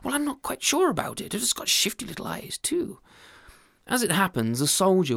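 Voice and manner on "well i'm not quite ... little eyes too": stammering, apologetic and higher; a sheepish husband making excuses; lightly voiced fluster.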